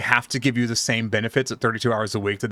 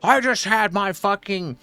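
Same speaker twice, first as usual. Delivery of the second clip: angry caller; 7 semitones higher than usual. Retold or imitated. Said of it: "cuss at me".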